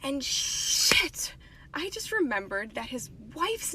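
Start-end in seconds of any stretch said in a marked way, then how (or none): 0.0-1.3 breathy whisper under her breath; whispered curse. Real, not acted. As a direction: whisper it as the mistake dawns. Realizes she mixed up bags.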